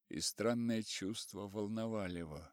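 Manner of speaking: ordinary delivery, nothing marked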